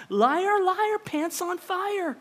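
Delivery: high, singsong schoolyard taunt; childish teasing chant; mocking taunt. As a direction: jeer at him like a playground kid. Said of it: "she calls Peter out".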